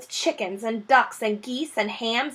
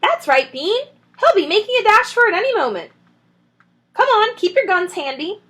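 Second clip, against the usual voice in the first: high, fast, louder urging; urgent Bean; 10 semitones higher, 5 dB louder than usual. Acted. Bean urging the other men, fast and eager. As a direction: hurry them along.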